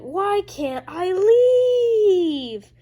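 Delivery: high, loud, very slow hammed-up cry; mock wail. Jailed Mamble whining to the cops, stretched mock wail. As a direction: drag it out like a pathetic prisoner.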